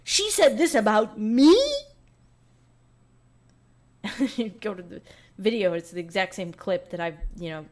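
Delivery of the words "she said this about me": high, drawn-out mock indignation; mocking whiny outrage. YouTubers reacting to her clip, mock whiny outrage. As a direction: whine it like an offended YouTuber. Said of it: "a lot of them were like".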